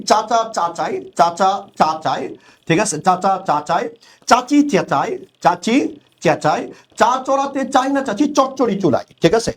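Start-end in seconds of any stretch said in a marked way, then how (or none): 0.0-2.4 "চাচা চাচাই" drawn out slowly, each চ clear; slow rhyme read to show the চ sound; slow demo. Shown as a demonstration. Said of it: "এরপর আমরা যদি বলি". 3.0-9.0 slow, "চাচি চেঁচাই" with each চ marked; teacher reads the rhyme slowly to students; slow rhyme demo. Shown as a demonstration. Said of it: "এরপর আমরা যদি বলি".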